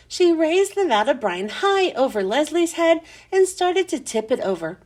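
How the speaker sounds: ordinary delivery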